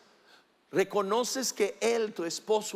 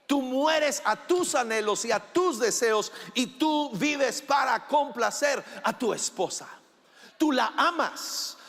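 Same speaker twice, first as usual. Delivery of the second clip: raised preaching voice to the husbands; preaching shout; 5 semitones higher than usual. Real, not acted. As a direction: push it out like a pulpit call.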